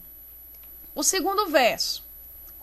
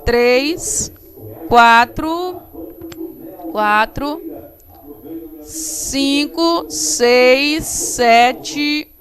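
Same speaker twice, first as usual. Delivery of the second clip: loud, spaced-out count; emphatic classroom counting with pauses; counting aloud; 8 dB louder, much slower than usual. Shown as a demonstration. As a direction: count firmly, one number at a time. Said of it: "eu vou contar".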